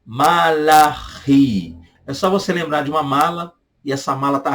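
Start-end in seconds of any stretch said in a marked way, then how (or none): 0.1-1.8 "Malachi" very slow, with a throaty ch; Hebrew pronunciation. Shown as a demonstration. Teacher showing students the Hebrew "Malachi", slowly. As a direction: draw out "Malachi" syllable by syllable. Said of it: "do hebraico Malachi".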